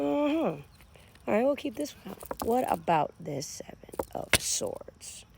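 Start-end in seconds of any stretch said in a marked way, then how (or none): 2.5-5.2 slow whispery card reveal; low, drawn-out hush. Real, not acted. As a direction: drop into a slow, breathy whisper.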